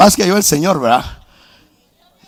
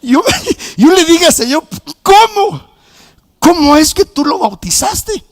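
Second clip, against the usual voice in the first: much higher and louder, an indignant cry at God; high, loud, slowed-down complaint; angry outburst; 12 semitones higher, 5 dB louder than usual. Retold or imitated. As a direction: protest like a jealous older brother. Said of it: "yo me enojé".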